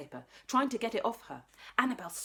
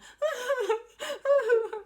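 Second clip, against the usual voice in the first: high loud crying wail; child's wail; 8 dB louder than usual. Acted. Annabelle in her mum's arms, wailing. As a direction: wail like a toddler. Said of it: "Annabelle started crying".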